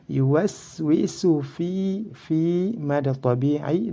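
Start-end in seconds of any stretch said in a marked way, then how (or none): 0.1-2.8 slow, higher chanted "Yuwaswisu fi" with long i; recited "Yuwaswisu fi", final vowel stretched; two-beat long vowel. Shown as a demonstration. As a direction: hold the i of "fi" two beats. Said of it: "dua harokat".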